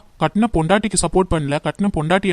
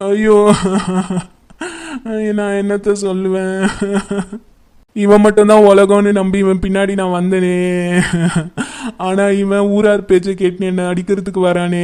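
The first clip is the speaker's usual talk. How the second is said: wailing lament. Acted. Wife bewails her husband turning on her, wailing. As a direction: wail it like a funeral dirge. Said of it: "ஏன் இப்படி ஒப்பாரி வச்சிருக்கீங்க".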